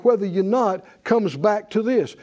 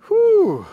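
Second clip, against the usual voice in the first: delighted whew; 11 semitones higher, 4 dB louder than usual. Real, not acted. Preacher reacts to the verse.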